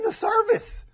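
very high, loud, drawn-out emphasis; incredulous exclamation. Real, not acted. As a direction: cry it out in disbelief. Preacher stressing the outrageousness.

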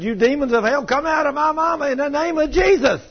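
put-on high, loud shout in a child's role; acted boy's high-pitched, shouted command; imitated exorcism command. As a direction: cast them out at the top of your voice. Boy commands demons out.

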